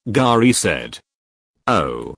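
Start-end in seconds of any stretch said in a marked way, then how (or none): 1.7-2.1 deadpan. A narrator's reading. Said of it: "Ash deadpanned".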